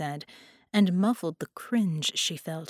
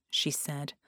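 usual speaking voice

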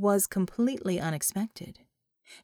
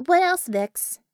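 higher, louder brisk prompt; brisk raised query to the mage; character voice; 8 semitones higher, 6 dB louder, slower than usual. A narrator's reading. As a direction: snap out a quick question, pitched up.

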